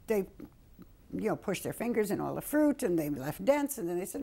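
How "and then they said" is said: normal talking voice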